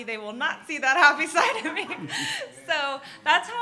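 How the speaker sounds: bubbly laughing delivery. Real, not acted. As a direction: let the laugh break into the line. Laughing while describing being happy.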